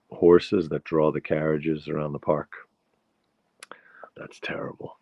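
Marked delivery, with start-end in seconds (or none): 4.2-5.0 soft, unvoiced whisper of dismay; breathless whispered disgust. Real, not acted. Reacts to horse droppings.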